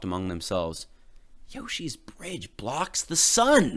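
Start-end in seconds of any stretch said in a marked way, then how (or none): 1.5-3.8 in anger. A narrator's reading. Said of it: "they said in anger".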